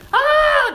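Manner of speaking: loud, high-pitched scream; sudden full-voiced "Ah!" scream; imitated scream. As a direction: let out a sudden piercing shriek, mimicking a terrified sister. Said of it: "awoken from my sleep just with screams".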